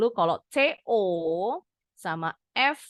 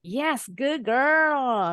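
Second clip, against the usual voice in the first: praising tone; 5 semitones higher, 4 dB louder, much slower than usual. Shown as a demonstration. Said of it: "agak dimelintirin gitu ya kalau muji".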